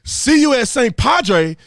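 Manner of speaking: loud mock shout of "See you at Saint Padre" with "Padre" as "PAH-dray"; mocking mispronunciation. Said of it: "Si se padre"? Really?".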